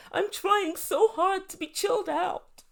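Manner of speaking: high, drawn-out imitation of crying; mock tearful wail. Upset guest protests after running out, mock tearful wail. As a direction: wail it slowly, sending up her waterworks. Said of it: "then came the waterworks".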